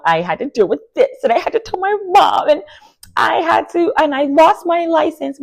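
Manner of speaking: high, hammed-up whining; mock whiny sob story. Recapper mimicking Brit's tearful excuse in a high, whiny mock voice. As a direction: imitate her teary woe-is-me complaint, lifted high. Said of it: "crying and saying, "Woe is me".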